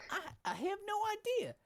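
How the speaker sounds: put-on squeaky falsetto girl voice. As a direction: switch to a high mock-girly voice. Voicing Alex, now female.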